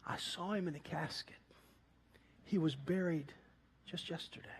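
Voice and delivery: whisper, the words barely voiced; whispered disbelief. Man on the phone whispers that the friend is buried. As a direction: hush it like a secret at a funeral. Hushed refusal to believe caller.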